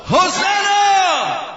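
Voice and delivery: long, high, loud shout; crowd shout. Shown as a demonstration. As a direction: lead the congregation in one big cry. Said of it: "Three, two, one, go!".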